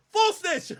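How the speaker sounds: "Full snitch" laughed out high; laughing. Real, not acted. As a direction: giggle through "Full snitch". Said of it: "stop the half snitching".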